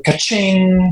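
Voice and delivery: high, drawn-out ka-ching; cash register ka-ching. Retold or imitated. Phone goes off at family dinner, imitated cash-register sound. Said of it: "that cash register".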